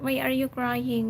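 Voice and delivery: ordinary delivery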